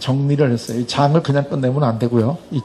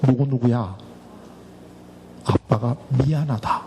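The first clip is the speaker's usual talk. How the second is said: gentle fatherly apology; 4 semitones lower, 6 dB quieter than usual. Pretend. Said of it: "내가 네 아버지 역할을 해줄게".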